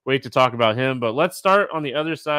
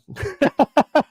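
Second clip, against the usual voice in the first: high laugh. Real, not acted. Laughs at own ice mishap.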